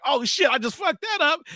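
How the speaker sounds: high-pitched mock panic; freaking out. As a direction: panic to yourself in a squeezed high voice. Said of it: "just, like, freaking out".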